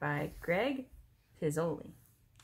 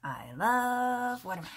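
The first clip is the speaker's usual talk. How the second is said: crocodile voice; 6 semitones higher, 5 dB louder than usual. Acted. Reading the crocodile aloud.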